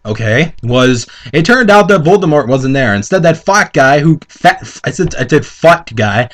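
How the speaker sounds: ordinary talk, nothing marked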